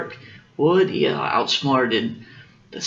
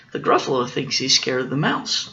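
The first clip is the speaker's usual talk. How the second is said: ordinary delivery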